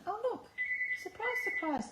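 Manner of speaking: high sarcastic surprise. Real, not acted. Phone rings mid-craft.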